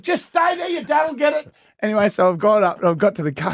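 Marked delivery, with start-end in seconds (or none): 0.0-1.5 high, coaxing mock call; imitating Mike. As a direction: steer the kid in a raised voice. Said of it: "Mike's gone".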